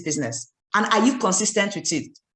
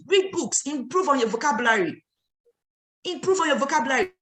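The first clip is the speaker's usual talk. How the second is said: ordinary talk, nothing marked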